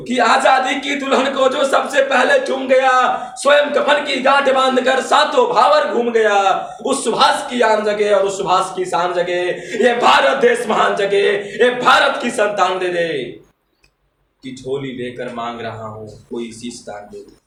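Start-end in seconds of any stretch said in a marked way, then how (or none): 0.1-2.9 high, louder heroic recitation; fiery declamation. Acted. Reciter honouring Subhash Chandra Bose, fiery declamation. Verse repeated at full force. 3.4-13.1 high, louder declamation of patriotic verse; fiery poetry recitation. Acted. Poet rousing the audience to wake India, fiery declamation.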